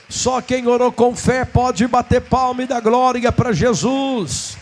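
usual speaking voice

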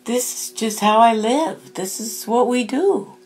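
high, loud mock indignation; hammed-up incredulous questions and emphatic denial; boisterous Irish ghost. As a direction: play an affronted, jovial spirit protesting innocence. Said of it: "And he goes".

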